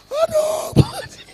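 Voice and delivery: comic falsetto grief cry; mock wailing. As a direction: shriek the name like a weeping mourner. Mourner voiced in funny story.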